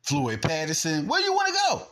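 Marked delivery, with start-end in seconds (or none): 1.1-1.8 very high-pitched taunt; taunting challenge. Real, not acted. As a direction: voice leaps way up, daring them. Taunting challenge to imagined doubters.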